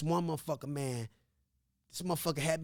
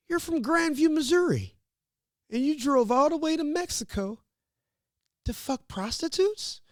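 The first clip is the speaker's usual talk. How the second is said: mock cop voice, much higher and louder, slowing at the end; incredulous officer; 11 semitones higher, 5 dB louder than usual. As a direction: ham up the officer's disbelief.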